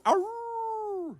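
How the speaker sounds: high mock wolf snarl; wolf growl. Actor shows the wolves' back-to-back growl. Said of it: "go back to back and go".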